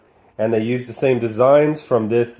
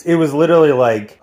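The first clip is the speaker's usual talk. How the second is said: ordinary talk, nothing marked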